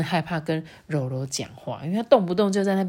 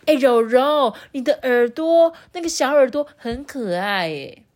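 higher, louder, friendly exclamation; admiring compliment; 5 semitones higher, 5 dB louder than usual. Acted. Someone compliments Rourou's little ears, brightly admiring. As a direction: gush lightly over something cute.

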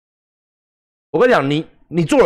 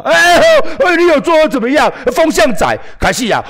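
high, loud jeering heckler; accusing heckler; 8 semitones higher, 3 dB louder than usual. Retold or imitated. Heckler accusing the streamer of riding the wind, high loud jeer. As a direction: jab at him like a taunting troll.

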